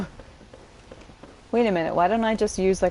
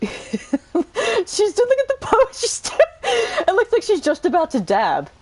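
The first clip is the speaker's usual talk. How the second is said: high, louder words through laughter; laughing; 13 semitones higher, 4 dB louder, slower than usual. Real, not acted. Mocking the butcher lady's pose, laughing. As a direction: giggle through it at the silly pose.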